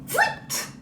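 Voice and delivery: high whistling slide sound; a quick swoosh as he slips; sliding whoosh. Acted. Narrator mimics the wolf slipping down the trunk, whooshing.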